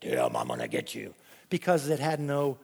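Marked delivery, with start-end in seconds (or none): 0.0-1.1 much lower, eerie growl; spooky snake voice. Pretend. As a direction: menace like a shadow monster at night. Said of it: "my snake guy just appear".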